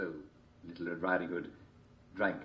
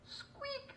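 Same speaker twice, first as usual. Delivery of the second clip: mouse squeak; 13 dB quieter than usual. A narrator's reading.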